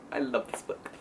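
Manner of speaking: breathy whispered confession of love; hushed devoted whisper; whispered gush. Real, not acted. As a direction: whisper it like a secret crush. Gushing over favorite book.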